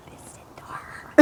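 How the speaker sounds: tiny whisper from behind someone; hushed, timid backing-up; demure quiet voice. Retold or imitated. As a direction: whisper it meekly. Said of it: "quiet voice".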